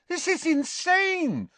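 very high, drawn-out exasperation; exasperated. A narrator's reading. Harry reacts to his lovesick attacker, exasperated. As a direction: stretch it out in high-pitched disbelief.

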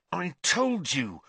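a sharp hiss of I-told-you. A narrator's reading. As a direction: Ron hisses a warning at Hermione. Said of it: "Ron hissed at Hermione".

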